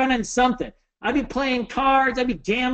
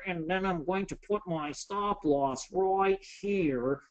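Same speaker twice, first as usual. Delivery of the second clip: mock careful trader; 6 semitones lower, 5 dB quieter, slower than usual. Mocking slow deliberate trader.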